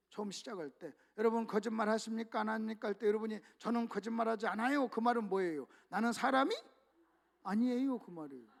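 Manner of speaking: ordinary delivery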